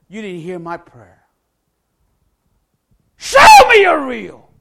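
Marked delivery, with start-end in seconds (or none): 3.1-4.5 slow, high, shouted ultimatum; defiant demand. Retold or imitated. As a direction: spell out each word as a dare. Final demand to God.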